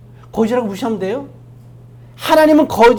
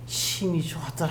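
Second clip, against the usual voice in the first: very soft, lower-pitched delivery; hushed quotation; 3 semitones lower, 11 dB quieter than usual. Acted. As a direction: let the verse sink into a hush. 같은 구절을 낮춰 되풀이. (낮고 아주 조용하게 읊조리듯)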